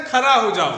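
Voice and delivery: खड़ा said loudly as खरा; loud imitation of खड़ा mispronounced with र; ड़ turned into र. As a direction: mimic the mistake, plain र in खड़ा. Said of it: "कहते हैं ना".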